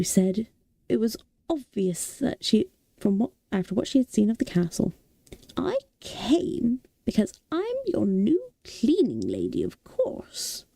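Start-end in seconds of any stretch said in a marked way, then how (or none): 5.6-10.7 put-on old woman voice. A narrator's reading. Old Sophie tells Howl she's his cleaner, slow old lady. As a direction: announce it like a prim old woman.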